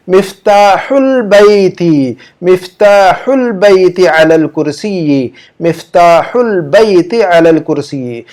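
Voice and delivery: मिफ्ताहुल Baiti said very slowly, each syllable drawn out; slow model Arabic. Shown as a demonstration. Teacher slowly modelling मिफ्ताहुल Baiti for students. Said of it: "इसकी अरबी यूं बनेगी".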